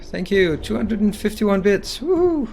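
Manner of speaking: high, loud cheering with drawn-out words; over-enthusiastic thanks. Real, not acted. Streamer thanking a viewer for bits, over-enthusiastic. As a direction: whoop out a big thank-you. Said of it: "I didn't sound, uh, too enthusiastic".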